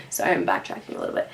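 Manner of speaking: quick hushed apology; whispered aside. Real, not acted. Storyteller apologizing to viewers for backtracking, quick whisper.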